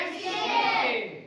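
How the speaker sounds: slow, high sung-out answer; chanting the answer to pupils; drawn-out answer. Shown as a demonstration. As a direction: stretch the answer out slowly for the class. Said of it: "क के लिए क्या लिख देंगे?".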